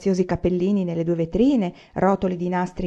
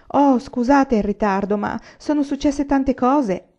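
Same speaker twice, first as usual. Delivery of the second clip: breathless apology; 7 semitones higher, 4 dB louder, slower than usual. Acted. Laura bursts in late.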